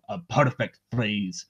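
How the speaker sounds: ordinary talk, nothing marked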